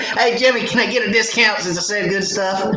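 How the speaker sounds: high, teasing wheedle; mock begging for a discount. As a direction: play the cheeky customer fishing for a discount. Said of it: "No, I'm just kidding".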